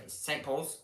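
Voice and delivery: normal talking voice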